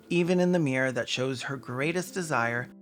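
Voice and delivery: ordinary talk, nothing marked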